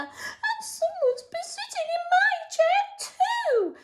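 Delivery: very high, tiny, tearful voice; a little cub near tears; sobbing Baby Bear. Acted. Storyteller voicing Baby Bear, high and tearful. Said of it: "sobbed Baby Bear".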